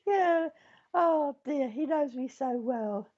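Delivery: high amused voice just after a laugh. Real, not acted. Host laughing off a friend's teasing, amused.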